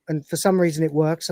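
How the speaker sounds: normal talking voice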